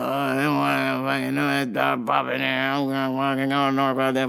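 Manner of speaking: low, run-together gibberish mumble; imitated mumbling. Customer to baffled worker, unintelligible mumbling. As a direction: mumble low so no word can be pieced together. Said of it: "he would just mumble".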